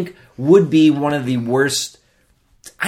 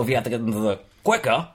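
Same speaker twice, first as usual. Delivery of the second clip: ordinary talk, nothing marked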